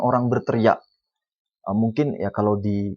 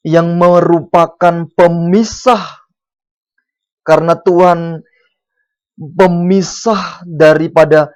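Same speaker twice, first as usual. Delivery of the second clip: loud, slow, drawn-out preaching; booming pulpit delivery; full-force preaching; 6 semitones higher, 9 dB louder, slower than usual. Acted. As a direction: hammer the verse home to the congregation.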